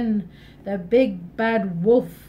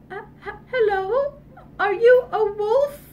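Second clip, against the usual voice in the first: put-on high, stammering frightened little girl; scared girl; 10 semitones higher, 4 dB louder than usual. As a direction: tremble as you ask. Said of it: "she's shaking".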